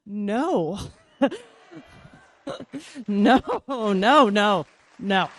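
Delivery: loud repeated no; emphatic no. Retold or imitated. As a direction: shut it down hard. Flat refusal of ex.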